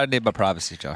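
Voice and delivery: normal talking voice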